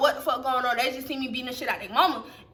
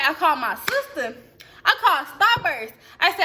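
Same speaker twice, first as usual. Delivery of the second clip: ordinary talk, nothing marked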